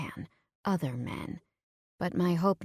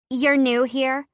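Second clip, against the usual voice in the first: put-on bright friendly girl; 7 semitones higher, 6 dB louder, slower than usual. Heather greets narrator at bar.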